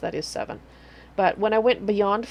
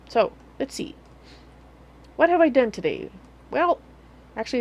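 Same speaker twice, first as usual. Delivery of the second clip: normal talking voice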